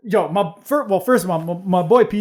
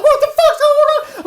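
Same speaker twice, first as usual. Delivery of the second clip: higher, laughing through a mom's squawk; laughing mock mom; 6 dB louder than usual. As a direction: play the shocked mom while cracking up. Mom arriving at the brawl.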